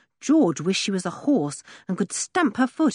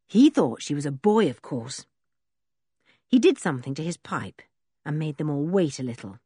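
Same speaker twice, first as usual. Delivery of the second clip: ordinary delivery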